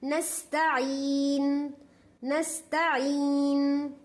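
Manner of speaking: नस्ताइन said very slowly with the s let flow on the breath; breathy seen. Shown as a demonstration. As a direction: let the air run on the s of नस्ताइन. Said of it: "सांस को आगे जाने देना".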